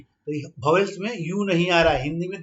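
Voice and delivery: usual speaking voice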